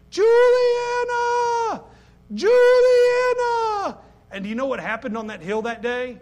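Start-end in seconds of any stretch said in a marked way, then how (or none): 0.1-4.0 long, very high, loud screaming call; frantic scream. Retold or imitated. Mother screaming for her lost daughter on the hill, frantic call. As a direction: scream the name like a panicked mother. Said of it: "began to scream like a crazy person".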